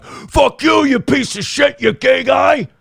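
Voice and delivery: high mock shouting; hammed-up, strained yell of insults; imitated mad outburst. Imitating a hater who gets madder, mock angry shouting. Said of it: "he just gets madder".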